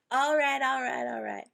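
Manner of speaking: sling it out like McConaughey; Matthew McConaughey catchphrase. Retold or imitated. Hammed-up Texan accent, quoting her favorite actor. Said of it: "Matthew McConaughey, uh, accent".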